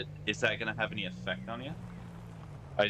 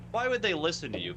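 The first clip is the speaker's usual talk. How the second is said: sarcastic; 8 semitones higher than usual. Real, not acted. Sarcastic rant about being ignored.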